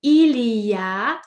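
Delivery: very slow, clear French question; slow model question. Shown as a demonstration. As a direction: ask it slowly for learners to copy. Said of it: "I'm going to ask you the question".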